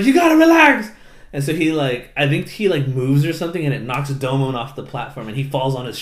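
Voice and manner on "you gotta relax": high, loud imitated yell; hammed-up exasperated call; mock exasperated shout. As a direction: yell it at a panicking kid. Pilot calls to panicking kid.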